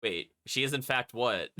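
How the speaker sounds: normal talking voice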